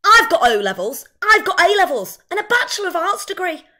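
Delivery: high, loud, indignant outburst; defiant shout. Acted. Waitress shouts at condescending customers from the bar, furious. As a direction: shout it at everyone who looks down on you. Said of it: "stand up on top of the bar and shout".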